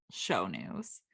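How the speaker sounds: unvoiced trailing whisper. Real, not acted. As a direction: whisper the last words. Whispered aside.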